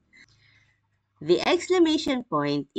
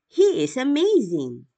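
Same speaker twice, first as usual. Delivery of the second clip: higher, louder admiring exclamation; excited exclamation; 6 semitones higher, 4 dB louder than usual. Shown as a demonstration. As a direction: sound truly amazed by him. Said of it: "said in a rising intonation".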